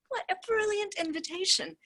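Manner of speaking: lifts high and bright on brilliant, savouring it; delighted exclamation. Real, not acted. Reader delights in the chapter title, high excited exclamation. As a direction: gush with delight over the title.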